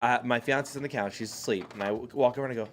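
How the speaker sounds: normal talking voice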